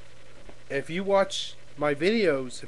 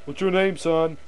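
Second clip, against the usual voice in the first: demanding, building to a loud high repeat; bullying cop; 4 semitones higher, 8 dB louder than usual. Acted. Cop demanding a suspect's name, louder and louder. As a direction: press the question harder until it is nearly shouted. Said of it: "cops that think they're top shit".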